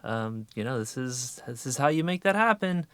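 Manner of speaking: mock-persuasive inner prodding, pitch climbing; nagging inner voice. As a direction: tempt with a rising, leading lilt. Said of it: "that voice that says things like".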